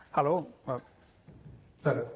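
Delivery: ordinary talk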